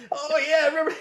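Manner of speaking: high, mock-delighted old-timer; forgetful old man. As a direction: play an old man rediscovering a memory. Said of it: "Soon it'll be like having a conversation".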